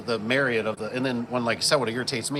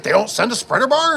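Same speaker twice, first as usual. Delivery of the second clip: high, louder incredulous question; disbelieving Caleb; 10 semitones higher, 8 dB louder than usual. Retold or imitated. Caleb finding no spreader bar, high louder disbelief. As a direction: Caleb can't believe it, voice jumps up.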